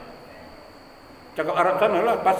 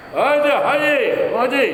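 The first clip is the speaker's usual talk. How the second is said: calling out; 7 semitones higher, 4 dB louder, slower than usual. Retold or imitated.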